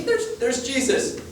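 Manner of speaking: a hint of Hobbit. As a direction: stretch it out in a small awed voice. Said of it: "Imagine, like, the Hobbits".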